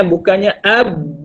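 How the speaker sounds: "ab" with an exaggerated bounce, slow; teacher showing overdone qalqalah. Shown as a demonstration. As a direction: hammer the b too hard, as the wrong version. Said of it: "tapi sikit sahaja".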